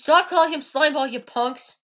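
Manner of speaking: loud, high stern order; commanding yell. Pretend. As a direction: shout it down at the townsfolk. Ordering townsfolk in game.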